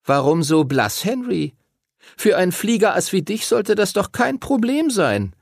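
high-pitched, mocking tease; mean taunt. A narrator's reading. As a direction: needle him with mock encouragement. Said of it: "während Timothy ihn gemein anlächelte".

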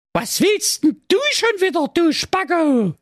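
very high, mocking princess; a high, scornful princess jeering at the count. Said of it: "sprach voller Freude".

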